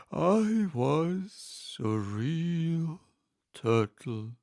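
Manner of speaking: slow, sorrowful turtle voice; mournful turtle. Acted. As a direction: let the grief weigh on each word. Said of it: "with a deep sigh".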